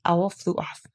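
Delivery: plain reading voice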